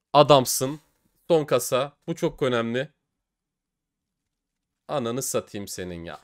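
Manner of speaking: usual speaking voice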